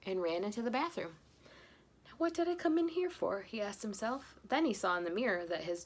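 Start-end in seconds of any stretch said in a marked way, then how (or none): 2.1-3.4 high, lost confused voice; puzzled. Acted. Forgetful husband lost in the bathroom, puzzled. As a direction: wonder aloud, baffled.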